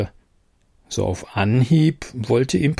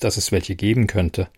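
ordinary delivery

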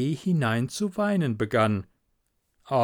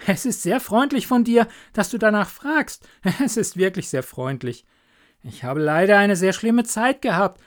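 higher-voiced, grateful young friend; eager little Hans; 7 semitones higher, 5 dB louder than usual. Hans thanking the miller for asking, eager. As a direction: gush happily in a raised voice.

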